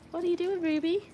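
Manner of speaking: high playful scolding of the dog; high dog talk. Real, not acted. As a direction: lift it up, teasing the dog. Talking to dog.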